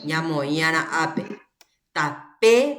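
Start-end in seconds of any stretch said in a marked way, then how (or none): 1.9-2.8 TAPEPE broken up, PE lengthened and emphasised; stressed syllable demonstration. Shown as a demonstration. Teacher checks the accent with students, stressed demonstration. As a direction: lean on the PE in TAPEPE. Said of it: "el acento a los que necesitan".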